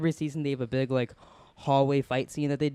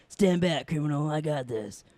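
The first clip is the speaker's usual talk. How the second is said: lightly imitated action-movie cop; eighties cop-movie hero; slower than usual. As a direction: do a mild, goofy eighties cop voice. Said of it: "like, cop movie".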